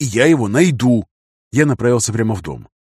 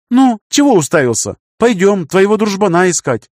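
put-on high, loud, impatient young woman; bossy girl voice; 9 semitones higher, 4 dB louder than usual. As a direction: snap at him, high and loud. Girl bossily calls him.

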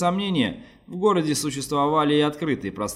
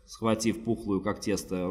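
usual speaking voice

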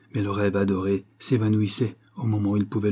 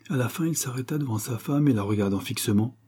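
ordinary delivery, nothing marked

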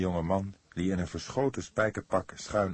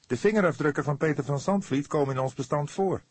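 higher, louder, urgent argument; passionate; 8 semitones higher, 6 dB louder than usual. A narrator's reading. Fledder argues passionately with the cook, fervent. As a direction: argue it with fire. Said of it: "betoogde hij hartstochtelijk".

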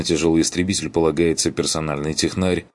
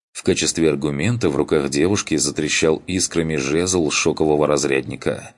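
plain reading voice

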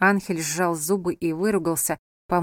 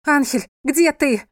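high, slow, loud call; loud desperate cry; 7 semitones higher, 6 dB louder, slower than usual. A narrator's reading. Wife cries out for her husband, loud desperate cry. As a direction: shout with your last strength. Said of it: "громко крикнула София, вложив в этот крик все последние силы".

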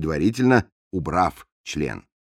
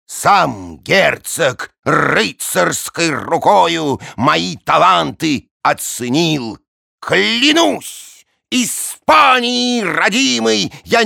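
slow, raised grand boasting; pompous don voice; 8 semitones higher, 4 dB louder, much slower than usual. Acted. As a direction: declaim like a vain nobleman.

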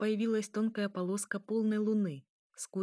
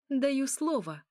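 higher, forceful promise; emphatic vow; 7 semitones higher, 4 dB louder, slower than usual. A narrator's reading. Elya repeats her threat to hidden enemies, emphatically. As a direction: swear it with lifted pitch.

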